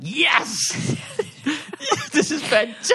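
high, excited squeal of delight; giddy. Retold or imitated. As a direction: burst out in giddy triumph. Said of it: "I get giddy".

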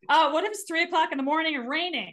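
high, rushed protest; exasperated, hurried comeback; mock exasperation. Acts out exasperated reply to advice.

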